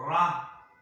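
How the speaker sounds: "ra" said as one loud, separate syllable; pronunciation demo. Shown as a demonstration. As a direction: sound out "ra" on its own, firmly. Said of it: "se pronuncia".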